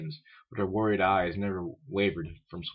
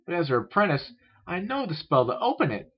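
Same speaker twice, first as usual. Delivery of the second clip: high, pleased filly voice; brightening; 9 semitones higher, 4 dB louder than usual. A narrator's reading. Apprentice filly about to unlock the library, brightening. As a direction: say it pitched up, sure of the solution. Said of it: "she said, brightening".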